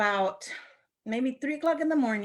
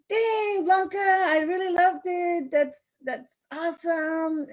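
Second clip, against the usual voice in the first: much higher, gushing praise; excited friend; 6 semitones higher, slower than usual. Retold or imitated. Friend Lydia praising the presentation, excited and high. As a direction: rave about it, pitched way up. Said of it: "Lydia was like".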